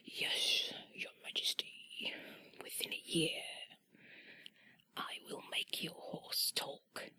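put-on whispered English-accented jester; slow, deferential whisper in an English accent. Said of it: "this was an English jester".

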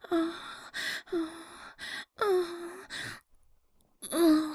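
usual speaking voice

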